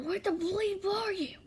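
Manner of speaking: high, rising yell; angry demand. A narrator's reading. Angry shout at listener in story.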